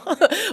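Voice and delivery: high burst of a real laugh; a sudden, emotional laugh. Real, not acted. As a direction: laugh out loud, moved. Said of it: "I'm getting emotional".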